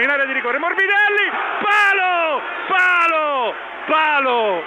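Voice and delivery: very high shout, fading on repeats; shouting commentary. Real, not acted. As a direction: yell the chance, then sag. Shot hits the post.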